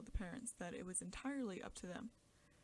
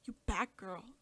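scolding mother; 9 dB louder, slower than usual. A narrator's reading. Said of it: "said the mother".

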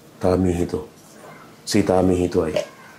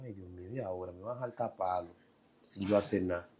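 tearful lament; 3 semitones lower, 14 dB quieter than usual. Retold or imitated. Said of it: "ya yo estaba llorando".

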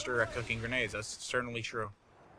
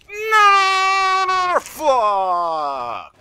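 loud frustrated scream at the screen; a real cry of defeat; anguished shout; 13 dB louder than usual. Real, not acted. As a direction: yell it as you get killed. Dies in game, screams.

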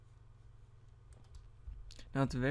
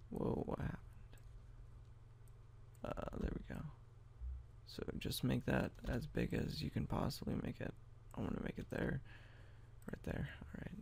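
whispered aside to himself; breathy whisper while working; 7 dB quieter than usual. Real, not acted. Muttering to self while editing.

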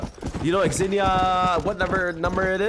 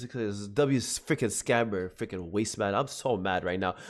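low mad grumble; 10 semitones lower, 7 dB quieter, slower than usual. Real, not acted. Fuming after losing money.

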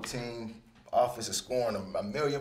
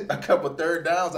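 laughing question; 5 semitones higher, 7 dB louder than usual. Retold or imitated. Coach's offer, laughing.

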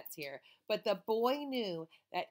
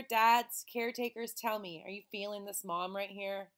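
ordinary delivery, nothing marked

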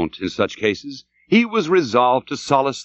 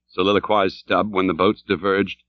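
usual speaking voice

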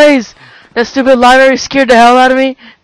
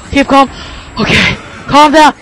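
normal talking voice

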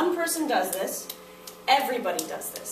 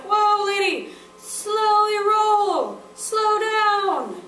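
much higher, louder, slow "slowly roll"; student asking to slow down; 10 semitones higher, 7 dB louder, much slower than usual. Shown as a demonstration. Teacher acting out a student asking her to slow down. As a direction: plead with the teacher to ease up. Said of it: "you're like".

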